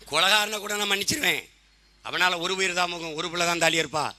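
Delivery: ordinary delivery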